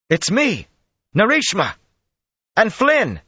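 high, loud hail in short bursts; shouted. A narrator's reading. Man identifies himself from beyond the stonework, shouting. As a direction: call out who you are from far off. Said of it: "a voice shouted from beyond".

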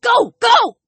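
high, urgent horse-driving cries; shouted. A narrator's reading. Jess drives Alex's horse onward, shouting. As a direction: yell to spur a horse on. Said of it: "Jess shouted".

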